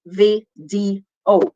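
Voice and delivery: slow vi, dee, o with gaps; syllable by syllable. Shown as a demonstration. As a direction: break "V D O" into three separate beats. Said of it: "Three syllables".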